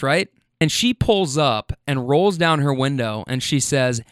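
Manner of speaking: ordinary delivery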